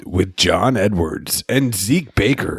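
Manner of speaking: lightly voiced announcer; a hint of a radio host. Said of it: "See, you're faking that voice".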